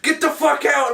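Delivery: high-pitched retold shout; exasperated mock yell. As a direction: act out losing patience with a scene partner. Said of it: "supposed to, like, yell".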